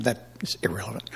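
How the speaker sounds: quick soft whisper; hushed, hurried aside; whispered dismissal. Real, not acted. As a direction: whisper it fast, brushing it off. Aside in a testimony.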